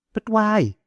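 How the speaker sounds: very high-pitched query; questioning woman. A narrator's reading. Woman presses the boy for his reason, high questioning. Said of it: "she asked again".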